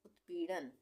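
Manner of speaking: ordinary delivery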